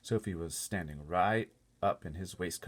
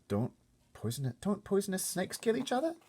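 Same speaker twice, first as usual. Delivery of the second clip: put-on high, pleading girl voice; desperate little girl; 5 dB louder than usual. Sophie searching for a creature as bad as humans, high desperate girl. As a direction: grasp for an answer like a desperate child. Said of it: "searching desperately".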